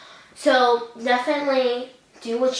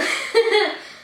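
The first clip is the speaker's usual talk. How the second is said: emotional laugh; 11 semitones higher than usual. Real, not acted. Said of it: "I'm a very emotional person".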